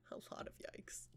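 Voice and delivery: breathy whisper under the breath; hushed, voiceless aside. Real, not acted. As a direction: whisper it softly to yourself. Aside after laughing at the bio.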